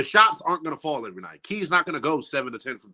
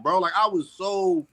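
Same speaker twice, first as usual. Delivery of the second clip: ordinary talk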